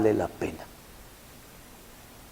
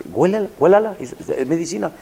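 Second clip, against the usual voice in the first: urgent insistence; 6 semitones higher, faster than usual. Retold or imitated. Pharmacist urgently telling the mother to smell it. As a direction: press her to smell it. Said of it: "Sí".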